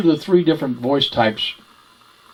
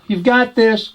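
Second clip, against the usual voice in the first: much higher, bright head voice; 9 semitones higher, slower than usual. Shown as a demonstration. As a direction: lift it up high. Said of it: "the three you can create voices with".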